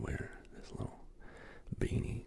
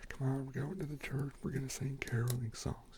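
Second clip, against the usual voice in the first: higher, mock accented grown-up voice; put-on accented voice; 4 semitones higher than usual. Retelling forced church caroling, in a higher put-on accent. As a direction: imitate a grown-up herding kids, in a silly accent. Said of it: "had the accent like".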